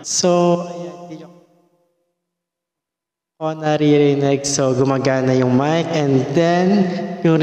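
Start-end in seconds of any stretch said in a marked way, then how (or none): none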